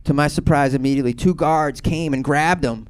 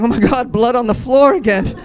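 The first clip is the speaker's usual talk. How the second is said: high, loud traumatized outburst; comic panic, pitched up and loud; mock horror; 10 semitones higher, 7 dB louder than usual. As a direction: cry out in hammed-up alarm. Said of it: "I see tots and I'm like".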